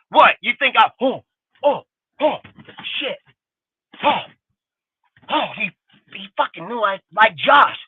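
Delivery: ordinary talk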